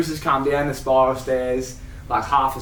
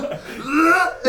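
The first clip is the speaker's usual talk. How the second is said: high laughing gag sound; mock retching bleh; 12 semitones higher than usual. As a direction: gag like the stink hit him. Plumber retching at the smell.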